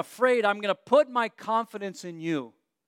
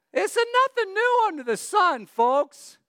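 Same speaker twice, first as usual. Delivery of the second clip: much higher, loud ironic quip; mock squeaky aside; 12 semitones higher, 4 dB louder than usual. Real, not acted. Preacher joking to congregation, high squeaky aside. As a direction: squeak it out as a joke.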